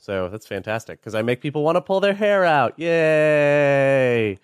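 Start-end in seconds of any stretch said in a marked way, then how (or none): 2.8-4.4 sarcastic cheer. Real, not acted. Sarcastic cheer after joke.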